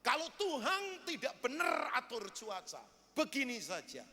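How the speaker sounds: retold angry challenge, building to loud orders; angry complaint. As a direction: act out an indignant farmer ordering God about. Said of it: "dia marah, dia marah, tahu nggak? Dia marah berkaca pinggang".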